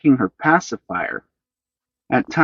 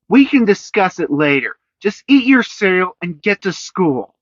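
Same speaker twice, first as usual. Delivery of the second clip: sharp; 8 semitones higher, 4 dB louder than usual. A narrator's reading. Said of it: "said Senny sharply".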